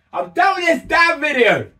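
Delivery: high-pitched, fed-up exclamation; exasperated outburst. Real, not acted. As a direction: throw it out high, totally done with it. Fed up, repeats for effect.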